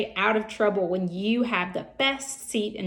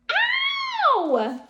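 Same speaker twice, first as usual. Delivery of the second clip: sudden high yelp of pain, ow; high scream; 3 dB louder than usual. A narrator's reading. Girl yelps ow when her pigtail is pulled. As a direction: shriek it like a hurt little girl. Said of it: "screamed Leslie".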